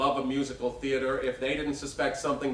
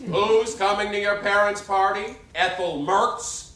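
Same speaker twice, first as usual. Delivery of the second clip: loud teasing schoolyard jeer through laughter; mock him like a playground bully; mocking kids; 6 semitones higher, 6 dB louder, slower than usual.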